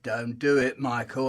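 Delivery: low, quiet warning voice; the little voice murmuring; soft inner voice. Retold or imitated. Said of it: "a little voice said".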